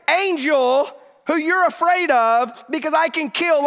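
the angel losing patience with a doubter; exasperated angel. Retold or imitated. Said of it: "Gabriel's like".

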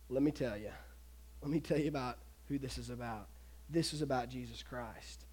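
soft, low, unhurried reveal; hushed, earnest telling; gentle explanation. Acted. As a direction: lean in quietly as a guide sharing the key truth. Philip gently explains, acted.

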